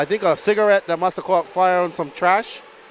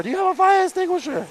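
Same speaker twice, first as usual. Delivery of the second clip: higher, imitating a frantic stranger; mock panicked guy; 10 semitones higher, faster than usual. Announcer mimics the guy rushing in, mock panic. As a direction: pitch it up like someone rushing in for help. Retelling the guy's panicked request.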